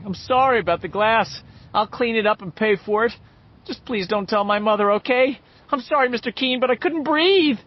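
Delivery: high, loud, tearful pleading; nearly crying. Acted. Boy begging the druggist not to tell, nearly crying. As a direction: beg him on the edge of tears. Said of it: "he said, nearly crying".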